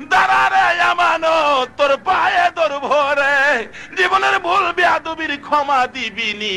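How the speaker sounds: high, drawn-out wailing chant; desperate pleading. Acted. Munsif begs Amanat's forgiveness, high wailing plea. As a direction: beg at the top of the chant.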